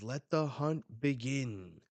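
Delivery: drawn-out announcer's call; grand announcer. Acted. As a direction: declare the games open. Voicing announcer starting the hunt.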